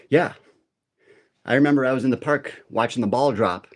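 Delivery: ordinary talk, nothing marked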